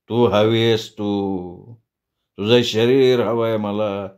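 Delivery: low, very slow predatory drawl; lecherous drunkard. Acted. Madhu refusing food and demanding her body, slow lecherous menace. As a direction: draw it out, drunken and leering.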